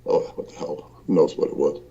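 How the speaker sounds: ordinary talk, nothing marked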